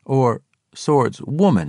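usual speaking voice

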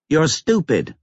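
put-on high-pitched little-boy insult; defiant small-boy voice; petulant child. A narrator's reading. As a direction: hurl it like a cross five-year-old. Five-year-old boy insulting his father.

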